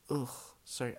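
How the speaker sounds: usual speaking voice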